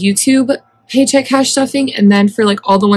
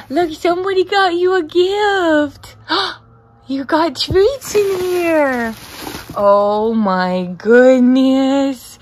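excited pet talk; 5 semitones higher, much slower than usual. Real, not acted. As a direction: coo excitedly to your pet over a present. Baby-talks to her pet.

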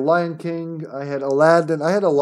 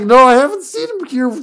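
laughing; 5 dB louder than usual. Retold or imitated. Retold own reply, laughing.

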